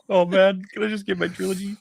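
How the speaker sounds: high pleading whiny voice; Dave Filoni. Retold or imitated. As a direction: whine it up high like a begging fan. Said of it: "He's probably like".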